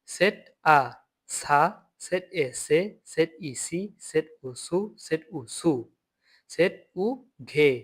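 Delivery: slow, syllable by syllable; slow spelling drill. Shown as a demonstration. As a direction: spell each সেট sound slowly. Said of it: "তারপর হচ্ছে সেট". (ধীরে ধীরে বানান করে)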